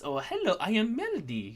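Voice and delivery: a lightly voiced bubbly tiefling girl; a hint of a sweet cleric introducing herself; Melody's voice. Shown as a demonstration. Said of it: "I make her talk kinda like this".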